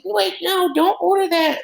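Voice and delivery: mock girl's high squeal; panicky girl impression. Teller imitates a girl stopping the drink order, panicky squeal. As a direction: put on a very high-pitched girl's panicked protest.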